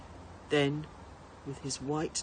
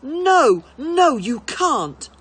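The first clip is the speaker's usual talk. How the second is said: much higher, much louder furious shout; slow, raging child's yell; boy screaming at his father; 9 semitones higher, 14 dB louder, much slower than usual. A narrator's reading. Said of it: "Bertie had ever shouted at his father".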